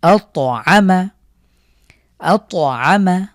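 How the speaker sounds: আত আমা very slow, ত bounced; slow, jolt the ত; slow qalqalah on ত. Shown as a demonstration. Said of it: "ধাক্কা দিয়ে পড়ব".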